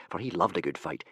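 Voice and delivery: ordinary delivery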